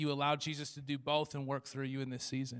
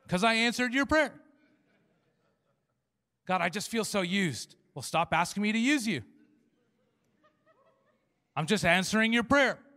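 loud, higher retort; exasperated God; 5 semitones higher, 5 dB louder than usual. Retold or imitated. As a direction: snap back at the complaint. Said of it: "God answering".

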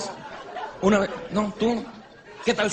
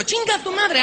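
mock-screamed curse, much higher; crazed wife yelling; 11 semitones higher, slower than usual. Comedian voicing the furious wife, high mock shriek. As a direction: shrieks it like a woman gone mad.

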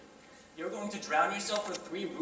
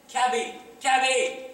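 louder hail for a cab; calling out; 4 semitones higher, 5 dB louder, slower than usual. Acted. Gentleman calling for a cab to escape, louder. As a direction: shout down the street for a carriage.

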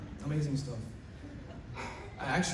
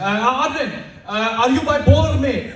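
loud, high, deadpan teacher question; mock teacher question; 7 semitones higher, 8 dB louder than usual. Teacher asking the student a startling question, loud mock voice. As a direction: ask it loudly as the teacher. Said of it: "he's like".

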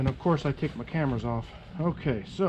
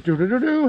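sung-out electronic startup jingle, rising and louder; imitated device chime; 7 semitones higher, 6 dB louder than usual. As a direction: mimic a gadget's power-on tones. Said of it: "And it goes".

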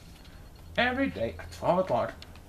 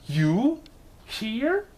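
louder, then a high rising question; surprised gasp; 8 semitones higher, 4 dB louder than usual. A narrator's reading. Mister Bird finds his wife alive and gasps, high and astonished. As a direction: gasp it out in disbelief. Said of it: "gasped Mister Bird".

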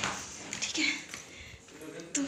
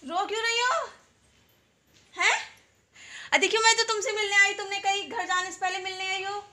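higher, pleading voice to soothe a crying grandmother; coaxing grandma; 6 semitones higher than usual. Real, not acted. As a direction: coax her gently to stop crying.

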